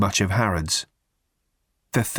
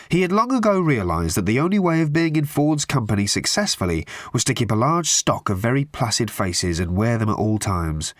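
usual speaking voice

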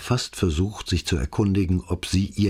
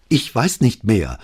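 slow, louder flustered stammer; a flustered policeman fumbling for words; confused stammer; 5 semitones higher, 6 dB louder than usual. A narrator's reading. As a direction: stumble over it, lost and rattled. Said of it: "stammelte Alvise verwirrt".

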